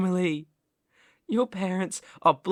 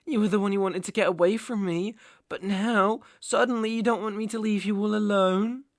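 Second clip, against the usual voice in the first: ordinary delivery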